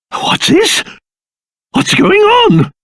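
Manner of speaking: high-pitched, startled questions; alarmed. Acted. King discovering something wrong in his castle, alarmed. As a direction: react with sudden alarm.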